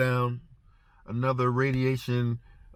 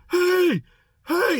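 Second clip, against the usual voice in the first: high, loud mock scream of hey; inmate screaming; 5 dB louder than usual. Inmate yelling from the bars to the guard, high loud shout. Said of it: "I hear this guy screaming".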